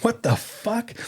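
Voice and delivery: high-pitched mock disbelief; retold disbelief. Handler hears his dog bit a policeman, high disbelief. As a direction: react in astonishment, pitched well up. Reacting to policeman being bitten.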